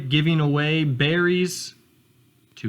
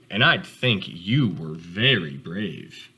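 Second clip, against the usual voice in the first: a proud father answering his son; deep calm fatherly reply; gentle father voice; 4 semitones lower, slower than usual. Acted. Father turtle praises son.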